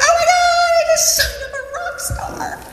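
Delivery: very high, gushing excitement; thrilled squeal. Retold or imitated. Recruiter calling a teammate about a new recruit, ecstatic squeal. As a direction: squeal the big news.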